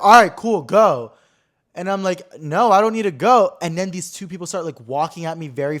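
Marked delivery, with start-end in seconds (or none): 0.0-1.1 louder, higher, curt brush-off; imitating a rude dismissal. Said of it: "being hella rude". 2.4-3.6 louder, higher, firm refusal; retold pushback. Pushing back at the officers.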